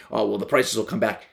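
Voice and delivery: ordinary talk, nothing marked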